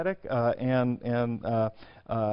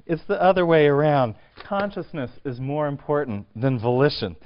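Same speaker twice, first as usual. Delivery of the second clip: insistent; 4 semitones higher, 6 dB louder than usual. Retold or imitated.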